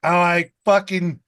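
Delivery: ordinary delivery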